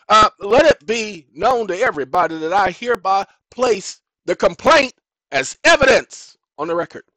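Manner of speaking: acted loud litigant addressing the bench; formal courtroom declaration. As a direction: declare it loudly for the court record. Acts formal declaration to judge.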